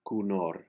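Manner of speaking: "cunor" said "cu-NOR", H's and T silent, stress on the end; first O as a U in "cunor". Shown as a demonstration. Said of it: "the first O is pronounced like a U".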